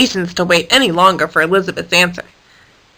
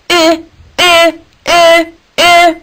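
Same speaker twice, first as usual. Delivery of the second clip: high, louder beeping eh sounds; an iguana beeping to wake the hippo; 8 semitones higher, 6 dB louder, much slower than usual. A narrator's reading. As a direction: beep like a little horn. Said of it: "Irene beeped at her".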